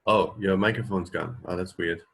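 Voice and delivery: normal talking voice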